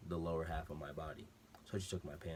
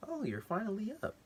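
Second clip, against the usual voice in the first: much higher, sing-song female voice; lady doctor voice; 4 dB louder than usual. Retold or imitated. Lady doctor greeting the waking patient, high and cheery. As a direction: chirp it up high like a cheery nurse.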